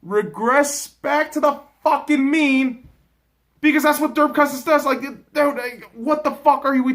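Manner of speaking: 1 high rant. Real, not acted. Ranting about Cousins.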